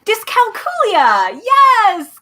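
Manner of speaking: high, drawn-out cheer of recognition; delighted exclamation. Real, not acted. Reader cheering a listener for knowing the word, delighted. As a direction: crow it with delight, pitch leaping up.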